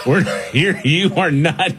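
high, laughing parental refusal; mock stern parent. Parent banning Peppa Pig to the kids, mock stern. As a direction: put your foot down, half laughing.

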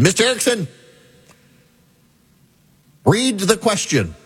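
a high, stern professor barking an order; enraged professor. Retold or imitated. Professor in full-on rage orders the student to read, high and stern. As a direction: play the furious law professor calling on the student. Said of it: "he was in full-on rage".

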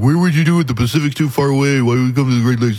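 mock the naysayers with a scoffing tone; mocking doubters. Host mocking listeners who doubt the pipeline, scoffing put-on voice. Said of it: "People are like".

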